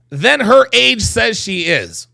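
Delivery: ordinary delivery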